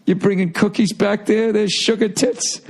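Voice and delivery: slow, high-pitched imagined taunt; drawn-out mock jeer; mocking put-down. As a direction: imitate someone sneering at your snack. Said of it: "like he was shitting on me".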